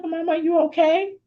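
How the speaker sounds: put-on high little-boy question; high-pitched, rising worried-kid voice; concerned young son. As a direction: imitate a small child checking on mom. Acts out her concerned son.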